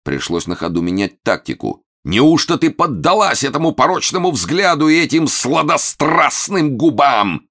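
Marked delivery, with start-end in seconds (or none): 2.0-7.5 high, loud melodramatic tirade; wailing. A narrator's reading. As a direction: howl in jealous anguish. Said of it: "завопил Момус". (вопит с театральным надрывом)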